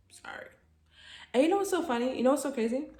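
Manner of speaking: normal talking voice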